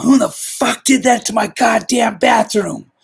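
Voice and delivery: high, slow, furious yell; stretched-out angry boss shout. Retold or imitated. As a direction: roar it like a boss who found his bathroom changed. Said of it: "And he's like".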